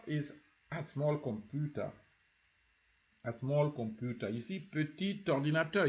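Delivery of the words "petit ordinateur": PETIT ORDINATEUR with a linking T; T sounded. Shown as a demonstration. Teacher points learners to the T in PETIT ORDINATEUR, T sounded. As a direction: carry the T of PETIT onto ORDINATEUR. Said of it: "you see the T".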